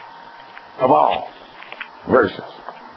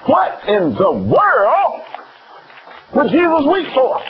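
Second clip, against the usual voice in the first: drawn-out question; 10 semitones higher, slower than usual. Acted. Rhetorical question to congregation.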